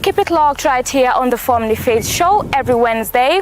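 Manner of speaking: loud, bright host announcer read over music; punchy presenter hype; upbeat show promo. Acted. Recorded show promo outro.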